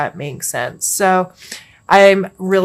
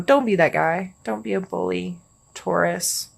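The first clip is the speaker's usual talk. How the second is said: ordinary talk, nothing marked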